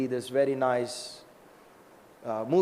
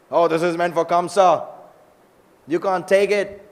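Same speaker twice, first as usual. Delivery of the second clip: loud, raised-pitch protest; acted stern refusal; 4 semitones higher, 6 dB louder than usual. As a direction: play someone firmly forbidding it. Guard refusing Krishna the clothes.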